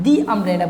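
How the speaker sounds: "the umbrella" with "the" said as "dee"; long "ee" vowel in "the" before "umbrella". Shown as a demonstration. Teacher repeating the correct example to students, "the" pronounced दी. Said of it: "दी बोलना है".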